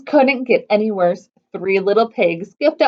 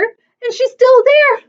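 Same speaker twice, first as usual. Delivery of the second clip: put-on higher and louder, gurgling baby bear; a gurgling bear complaint, raised pitch; gurgled; 11 semitones higher, 6 dB louder, slower than usual. A narrator's reading. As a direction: gurgle it out like a distressed cub. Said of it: "gurgled Baby Bear".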